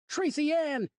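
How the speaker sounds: high, loud frantic call; shouting for daughter. A narrator's reading. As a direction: call out across the park. Father frantic calling.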